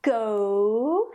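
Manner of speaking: "go" stretched, gliding from o to oo; long English diphthong in "go"; diphthong glide. Shown as a demonstration. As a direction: draw out both vowel sounds in "go". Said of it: "you hear actually two different sounds".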